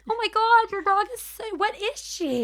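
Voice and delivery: very high gushing cartoon voice. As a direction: squeal over the dog like a cartoon. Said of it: "a cartoon voice".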